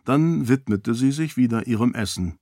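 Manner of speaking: plain reading voice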